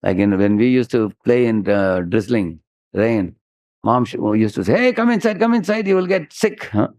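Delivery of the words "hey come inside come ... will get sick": high, mock-anxious mother calling the kids indoors; a mildly voiced fussing mom. Mother calls children in from the drizzle, higher fussing call.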